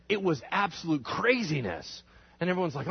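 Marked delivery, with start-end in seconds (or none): none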